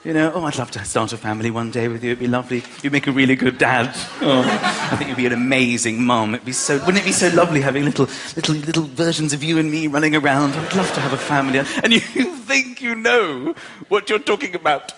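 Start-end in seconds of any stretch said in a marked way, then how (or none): 0.3-11.6 put-on cooing childless couple. Mocking childless couples cooing about a family. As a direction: coo it like a smug couple dreaming of kids.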